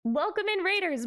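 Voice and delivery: high chirpy welcome; cute anime streamer. Acted. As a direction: greet the raiders in a cute high voice. Stream intro greeting.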